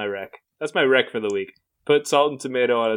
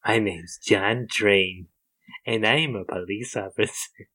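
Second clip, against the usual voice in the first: slow, put-on police officer voice; slow cop voice; 3 semitones lower than usual. Co-host offering a voice option, in a slow put-on cop voice. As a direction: introduce yourself slowly like a gruff cop. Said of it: "pick whichever voice you like more".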